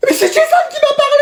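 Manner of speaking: mock whining. Unfair detention protest.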